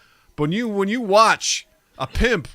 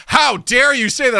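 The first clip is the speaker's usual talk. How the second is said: louder, higher, slow outrage; indignant customer; 8 semitones higher, 7 dB louder than usual. Retold or imitated. Customer whose card was declined, outraged, loud and slow. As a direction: play the offended customer. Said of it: "And they're like".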